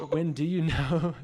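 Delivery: laughing. Real, not acted. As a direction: ask it while laughing, dragging the words. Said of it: "I'm getting hashtag heated".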